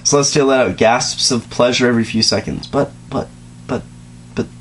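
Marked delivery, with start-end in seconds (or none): 2.7-4.5 soft unvoiced stammering; whispered stammer. Real, not acted. Reader whispering a stunned stammer. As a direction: stutter it in a whisper.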